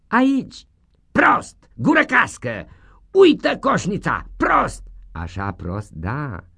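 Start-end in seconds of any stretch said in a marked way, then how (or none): 0.1-0.7 pupil answering. Acted. 1.1-4.8 high, loud contemptuous yell; loud scolding teacher; scolding schoolmaster. Acted.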